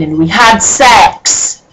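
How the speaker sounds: slow, drawn-out deadpan read; mock dramatic. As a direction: drag it out for comic effect. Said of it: "is that stupid?".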